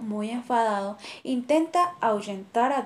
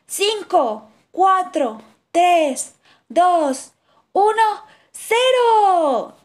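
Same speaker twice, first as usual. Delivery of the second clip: rising, louder count to zero; excited countdown; 7 semitones higher, 7 dB louder, much slower than usual. Acted. Kangaroo counts down before jumping, excitedly. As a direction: count down to launch, louder each number.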